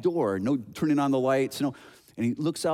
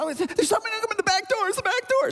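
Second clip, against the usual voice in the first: put-on very high frantic child voice; panicked little boy. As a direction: squeal it out as a terrified kid. Frightened boy answers dad.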